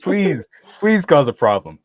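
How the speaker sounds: hungry mock plea, slow; mock eager plea. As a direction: plead with relish for trouble. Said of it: "I was like".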